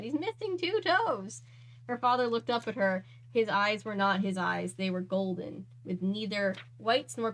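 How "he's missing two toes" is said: awed repeat. A narrator's reading. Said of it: "repeated in changed voice".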